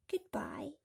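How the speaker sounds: a soft whispered farewell. A narrator's reading. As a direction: breathe a resigned goodbye. James says farewell as peach falls.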